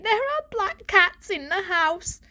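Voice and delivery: slow and high, leaning on "are"; young cat insisting to family; insistent. Acted. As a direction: press the words, slowly. Varjak insisting.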